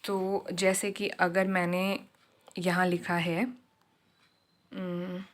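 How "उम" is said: ordinary talk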